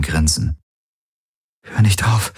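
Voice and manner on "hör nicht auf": lightly gasped plea, a bit higher; breathless murmur, mildly acted; gasping. A narrator's reading. Kirai begs her lover mid-embrace, lightly gasping. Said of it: "keuchte sie".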